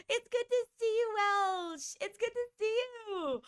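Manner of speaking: high, happy hello; high-pitched happy welcome to a friend; delighted greeting. Real, not acted. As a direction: sing out a glad welcome. Greeting returning viewer.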